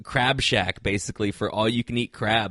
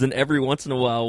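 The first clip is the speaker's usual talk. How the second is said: usual speaking voice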